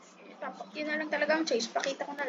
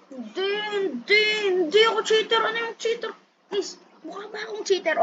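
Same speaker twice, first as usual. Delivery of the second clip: high mock-offended denial; 8 semitones higher, 3 dB louder than usual. Real, not acted. Denying cheating to viewers.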